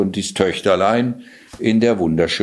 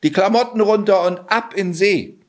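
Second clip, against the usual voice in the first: higher, urgent cat commands; 10 semitones higher than usual. Acted. Cat rushes Johann to undress, higher and urgent. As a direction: push him into the lake right now. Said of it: "drängte der Kater".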